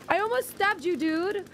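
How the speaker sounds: real shocked cry at teammate; alarmed exclaim. Real, not acted. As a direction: gasp it out in shock. Nearly hit teammate.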